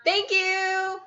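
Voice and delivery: high, drawn-out "thank you"; restrained cheer. Real, not acted. Co-host thanking a donor near bedtime, restrained high-pitched cheer. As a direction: cheer the thanks without shouting. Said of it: "trying not to be super loud".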